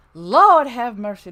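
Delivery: loud, slow Lord have mercy; drawn out loud appeal to heaven; loud, slow sigh of a tired son; exasperated exclamation. Real, not acted. Exasperated after mom leaves.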